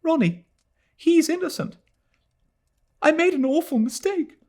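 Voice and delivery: put-on a girl confessing to her fiancé; distraught young woman. A narrator's reading. Adela's voice, distraught.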